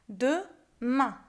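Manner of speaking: "De main" as two separate syllables. Shown as a demonstration. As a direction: pause clearly between "De" and "main". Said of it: "on va procéder à un découpage".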